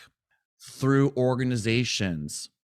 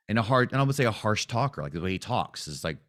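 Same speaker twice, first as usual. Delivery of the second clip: ordinary delivery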